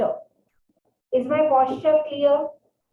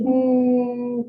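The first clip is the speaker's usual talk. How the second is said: steady bee hum; bee humming. Shown as a demonstration. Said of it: "breathe out like you are a bee".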